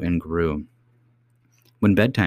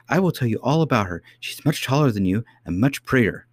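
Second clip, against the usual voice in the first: quick, higher little princess; child princess, insistent; 6 semitones higher than usual. A narrator's reading. Princess to doubting nurse about her grandmother, insistent. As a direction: insist like an eager child, pitched up.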